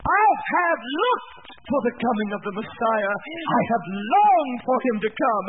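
normal talking voice

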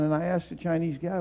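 ordinary talk

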